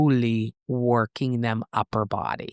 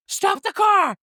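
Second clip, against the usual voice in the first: retold scream; slower than usual. Anya screaming at Church to stop the car. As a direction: shout it like you need the car to stop now. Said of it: "she screams at Church".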